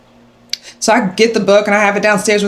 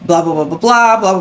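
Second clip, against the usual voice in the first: ordinary delivery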